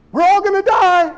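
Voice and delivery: high, hammed-up doom cry; comic panic, pitched high; mock panic. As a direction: play the panicking crowd.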